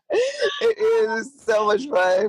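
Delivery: laughing through It is so much fun, high and stretched; real giggle into slow words. Real, not acted. Host laughing with guest.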